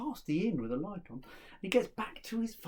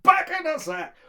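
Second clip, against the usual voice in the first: loud, high desperate yell; shouted command; 12 semitones higher, 11 dB louder than usual. Acted. Son orders the cudgel back, desperate shout. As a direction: shout it with all urgency. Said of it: "just shout".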